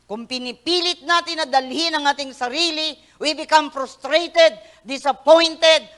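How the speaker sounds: loud, driving sermon delivery; full-force preaching. Acted. Pastor exhorting the congregation loudly. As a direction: preach it hard to the crowd.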